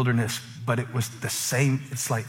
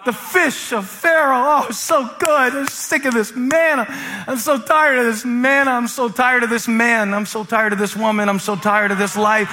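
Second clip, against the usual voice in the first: high, whiny mock lament; hammed-up complaining; 10 semitones higher, 6 dB louder than usual. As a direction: play the fed-up grumblers, raised in pitch. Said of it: "they started complaining".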